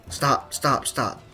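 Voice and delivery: ordinary talk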